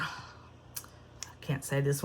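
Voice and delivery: slow, sheepish whisper; whispered aside. Real, not acted. Whispering to viewers that she can't say the leather name. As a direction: breathe it as a little confession.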